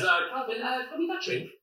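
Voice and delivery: high-pitched imitation of a woman; girlfriend's voice. Girl behind him speaks to the man, high imitated female voice. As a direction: put on a high, girlish voice. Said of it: "She says".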